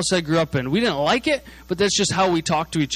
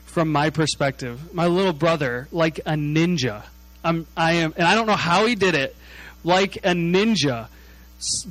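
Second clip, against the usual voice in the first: usual speaking voice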